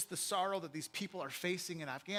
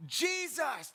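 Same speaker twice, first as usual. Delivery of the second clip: higher-pitched panicked yell; 8 semitones higher, 4 dB louder than usual. Acted. Disciples yelling at sleeping Jesus in a storm, panicked yell. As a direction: yell to wake him in a storm. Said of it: "and they're yelling".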